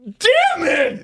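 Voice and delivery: high exclaimed curse; a half-laughing cry of defeat; hammed-up dismay at the result; mock dismay. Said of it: "And I'm like".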